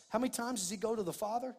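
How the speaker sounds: ordinary talk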